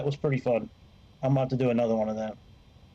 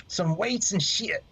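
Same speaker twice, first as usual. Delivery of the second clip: mock goofy voice; 6 semitones higher than usual. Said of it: "That's what we call it".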